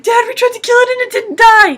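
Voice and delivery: high, loud alarmed cry; panicked shout. Retold or imitated. Kids running to dad after the roach survives, shouting in panic. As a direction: call for help in full panic. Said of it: "It's like".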